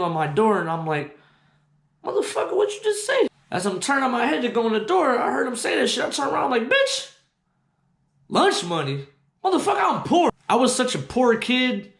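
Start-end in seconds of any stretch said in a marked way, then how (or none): 2.0-3.3 high-pitched, incredulous comeback; outraged high retort. Retold or imitated. Twelve-year-old snapping back at a bully, high and outraged. Retelling his angry reply. 6.7-10.3 high, disbelieving yelp; incredulous outburst. Retold or imitated. Poor kid rejecting a lunch money demand, high and indignant.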